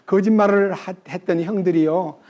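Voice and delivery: ordinary delivery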